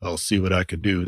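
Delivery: a hint of a dry, droll British singer, said low and soft. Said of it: "[name]'s exaggerated dialogue voices".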